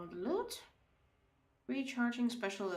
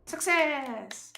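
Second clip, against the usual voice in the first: loud, high, drawn-out cheer; exultant victory shout; triumphant cheer; 6 semitones higher, 11 dB louder than usual. Real, not acted. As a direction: crow it out in triumph. Cheering a win in game.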